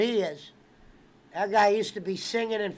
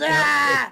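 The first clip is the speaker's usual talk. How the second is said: short loud higher cry of attack; mock strangling yell; 4 semitones higher, 6 dB louder than usual. Retelling the What About Bob strangling scene, loud cry. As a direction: shout it as he lunges. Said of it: "And he goes".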